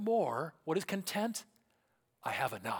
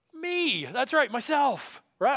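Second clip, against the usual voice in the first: emphatic answer; 10 semitones higher, 7 dB louder, slower than usual. Real, not acted. Emphatic answer to own question.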